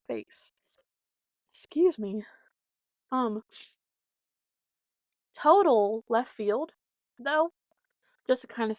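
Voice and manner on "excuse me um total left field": usual speaking voice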